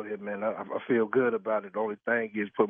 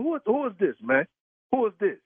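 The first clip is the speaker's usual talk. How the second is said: higher, angry demanding who's calling; 8 semitones higher than usual. Real, not acted. Husband demanding who the caller is, angrily. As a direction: confront the caller hard.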